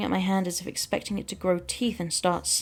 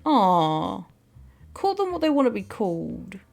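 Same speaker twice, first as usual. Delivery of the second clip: disappointed alien. Acted. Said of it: "They deflated, shoulders sinking in disappointment".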